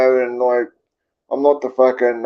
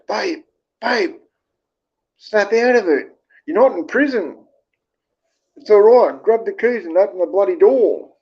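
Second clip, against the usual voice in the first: put-on higher-pitched retelling of a girlfriend urging him on; imitated urging, pitched up; imitated exasperated girlfriend; 6 semitones higher than usual. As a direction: act out a partner coaxing, then insisting.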